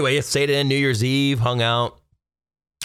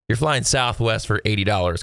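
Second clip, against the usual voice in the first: normal talking voice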